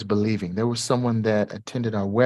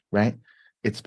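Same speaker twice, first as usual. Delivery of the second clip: ordinary talk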